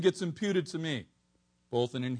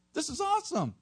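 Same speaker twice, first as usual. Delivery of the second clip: excited; 11 semitones higher than usual. Real, not acted. Preacher exclaims.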